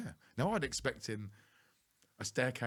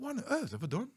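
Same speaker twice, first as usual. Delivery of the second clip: panicked squeak; 5 dB louder than usual. Retold or imitated. Panicked thought in cubicle.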